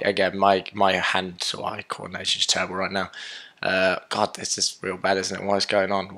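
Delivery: ordinary talk, nothing marked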